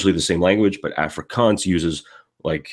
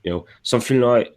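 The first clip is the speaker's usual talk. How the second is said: usual speaking voice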